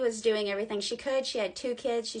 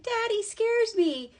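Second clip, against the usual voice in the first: high, slow imitation of a frightened child; scared kids; 11 semitones higher, 4 dB louder, slower than usual. Kids telling mom that Daddy scares them, mock-scared child voice. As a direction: voice a scared little kid, pitched well up. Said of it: "the kids are like".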